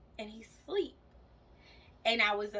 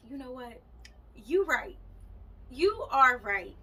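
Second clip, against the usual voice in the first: growing resolve; 4 semitones higher, 7 dB louder than usual. Retold or imitated. Agreeing with her aunt, gaining resolve.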